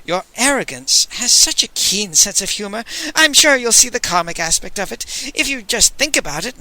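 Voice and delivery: nervous flattery. Acted.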